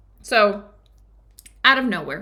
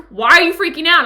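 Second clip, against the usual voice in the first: retold exasperated question, higher and louder; annoyed friend; 8 semitones higher, 11 dB louder than usual. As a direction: demand an answer, fed up. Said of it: "And he gets mad at me".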